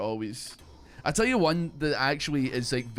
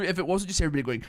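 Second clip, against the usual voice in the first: ordinary talk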